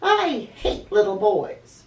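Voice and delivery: slow, loud, spiteful complaint; furious. Acted. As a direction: spit it out in anger. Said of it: "Angelina was furious".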